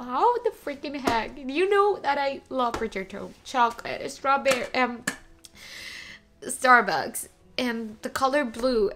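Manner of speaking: gushing loudly over each gift. Real, not acted.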